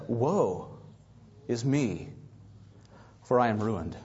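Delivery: low, hushed "Woe is me"; "Woe is me" dropped low and soft in awe; solemn lament. Retold or imitated. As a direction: say "Woe" low and grave, like a man undone. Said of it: "He said".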